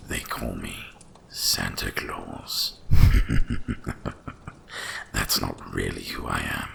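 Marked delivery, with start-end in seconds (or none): 2.8-5.1 loud, breathy rolling chuckle; wry dragon laugh. Acted. Dragon amused at being called Santa Claus, rolling chuckle. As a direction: let out a long, knowing chuckle.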